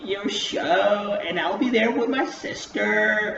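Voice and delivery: high, slow gushing mock voice; mocking Nikki. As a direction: drag it out like a fake-sweet fan. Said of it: "And then Nikki's like".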